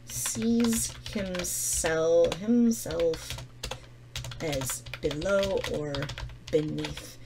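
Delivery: very slow, drawn-out words with gaps; dictating while writing. Real, not acted. Teacher writing down how he sees himself, speaking slowly. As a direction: stretch each word as if writing it down.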